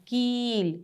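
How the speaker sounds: कील with a long, drawn-out ee; long vowel. Shown as a demonstration. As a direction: stretch the ee in कील. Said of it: "इसको थोड़ा लंबा बोलेंगे".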